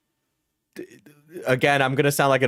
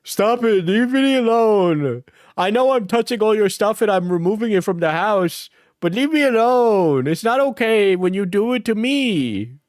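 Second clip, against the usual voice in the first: mocking Alex; 8 semitones higher than usual. Streamer acts out Alex's reply in a pitched-up whine. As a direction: whine it like a hypocrite begging. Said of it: "Alex's response".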